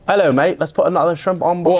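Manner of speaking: jokey mock Australian accent. Teasing about an actor thought Australian, mock Australian accent. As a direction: put on a broad Australian accent. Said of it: "He's Austra-, oh".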